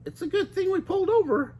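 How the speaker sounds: high cartoon girl, worried; high worried Daphne. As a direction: fret about the storm. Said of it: "said Daphne".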